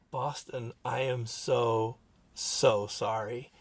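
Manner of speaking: very slow, low, sincere; heartfelt apology. Retold or imitated. Father apologizing to the son he yelled at, heartfelt.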